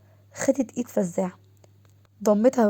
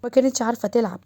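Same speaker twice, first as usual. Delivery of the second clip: usual speaking voice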